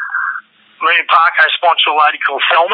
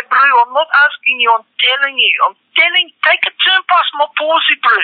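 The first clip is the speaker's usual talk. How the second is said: higher put-on township voice; higher mock local voice; imitating the locals; 9 semitones higher than usual. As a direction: mimic a local insisting, bro. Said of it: "and they say".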